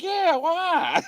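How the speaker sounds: relaxed guy. Retold or imitated. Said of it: "the guy's like".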